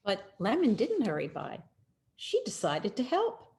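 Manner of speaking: usual speaking voice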